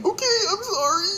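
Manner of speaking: very high, trembling apology; imitating a panicked child; scared kid. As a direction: squeak out the sorry like a frightened kid.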